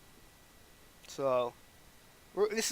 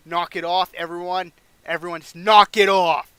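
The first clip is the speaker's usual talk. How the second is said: mock shouted order, building louder; Eric Bischoff screaming; 10 dB louder than usual. Boss comes out screaming at brawlers, mock shout. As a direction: yell to break up the brawl. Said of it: "Eric Bischoff comes out screaming".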